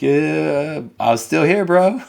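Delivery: high, teasing protest at being left in the dark; a dad joking that his son shut the light off on him; amused mock protest. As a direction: play it wry and amused. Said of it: "I'm like".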